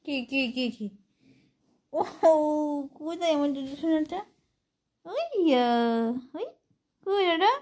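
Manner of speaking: high, loud calling to a pet. Real, not acted. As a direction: coax the pet awake, sing-song. Calling pet in baby talk.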